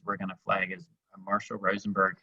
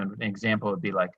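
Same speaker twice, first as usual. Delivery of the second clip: ordinary delivery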